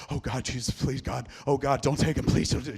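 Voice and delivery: imitated panicked, desperate begging, loud and breathless; hyperventilating pleading. Retelling a woman's terror as a life hangs in the balance, hyperventilating. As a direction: rush out a frightened, crying plea in mimicry. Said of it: "She was hyperventilating".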